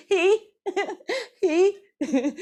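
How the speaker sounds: very high loud he amid laughter; giggly he. Shown as a demonstration. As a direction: squeal the he and laugh. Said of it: "Last one".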